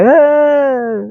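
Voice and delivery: a high, far-off wailing shout; spirit's scream. Retold or imitated. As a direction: cry out like a spirit arriving from the river mouth. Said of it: "el que gritó duro". (grito agudo y lejano de espíritu)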